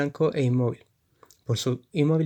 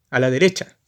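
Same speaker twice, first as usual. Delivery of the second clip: orden; 6 dB louder than usual. Acted. Militar repite orden.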